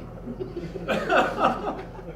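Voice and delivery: high, helpless giggle; real laugh. Real, not acted. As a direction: crack up at your own line. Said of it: "I said that".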